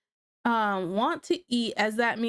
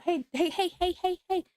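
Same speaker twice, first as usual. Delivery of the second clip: high sing-song hey hey hey, then mock complaint; playful scolding call; 7 semitones higher than usual. Real, not acted. Mother stopping her daughter to ask for a hug, playful high voice. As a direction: coax her back for a hug.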